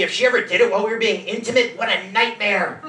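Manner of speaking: ordinary talk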